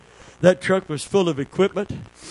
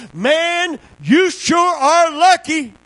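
crowd voice; 11 semitones higher, 4 dB louder, slower than usual. Retold or imitated. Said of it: "somebody in the crowd said".

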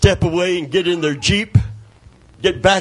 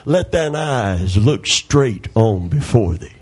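solemn scripture reading; 6 semitones lower, slower than usual. Acted. Quoting scripture in sermon.

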